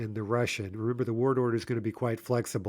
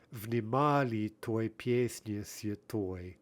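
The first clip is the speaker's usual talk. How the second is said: внимали той песне святой spelled out slowly, beat by beat; slow, rhythmic reading; much slower than usual. Shown as a demonstration. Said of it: "so again, that last line".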